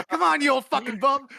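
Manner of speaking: high, loud mock taunt; taunting yell. Tommy Gunn jeering at old Rocky, loud high taunt. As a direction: jeer at the old fighter. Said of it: "he's like".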